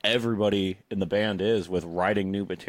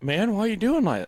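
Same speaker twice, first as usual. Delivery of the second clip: high, mock-wounded protest; imitating Zildjian; 10 semitones higher than usual. Imitating a sensitive bandmate objecting, high mock hurt. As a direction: whine like a touchy friend who got teased. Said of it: "He's pretty sensitive".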